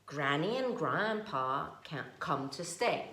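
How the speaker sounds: ordinary delivery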